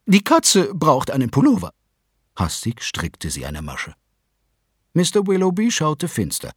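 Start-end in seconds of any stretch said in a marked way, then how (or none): 0.1-1.7 much higher, dismissive wife; Mrs Willoughby's voice. A narrator's reading. Mother refuses to read, high and dismissive. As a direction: brush them off from on high. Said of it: "sagte Misses Willoughby".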